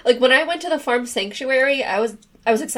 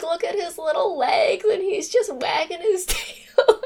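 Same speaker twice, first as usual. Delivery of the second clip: high-pitched, half laughing, half crying; tearful laughing; 7 semitones higher than usual. Real, not acted. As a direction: gush through happy tears. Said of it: "I'm, like, crying now".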